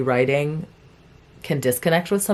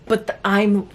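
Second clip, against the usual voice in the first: ordinary talk, nothing marked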